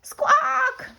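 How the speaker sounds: very high squawking hen cry; a frightened golden hen squawks. Acted. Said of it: "heard a squawk".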